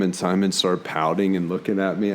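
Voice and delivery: usual speaking voice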